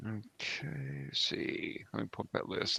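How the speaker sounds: very quiet, half-whispered aside; muttering to self. Real, not acted. Player hunting for the list, muttering to self. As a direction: think aloud under your breath.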